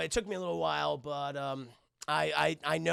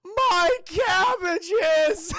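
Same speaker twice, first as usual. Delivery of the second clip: very loud, high, drawn-out mock cry; anguished wail; 12 semitones higher, 10 dB louder, much slower than usual. Merchant hit by the sliders, hammed-up anguished scream. Acts vendor's cry after the crash.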